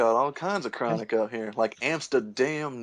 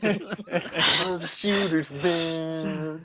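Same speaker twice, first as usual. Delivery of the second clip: put-on stoned teen voice; 4 semitones higher, much slower than usual. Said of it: "sounds like a stoned out seventeen-year-old".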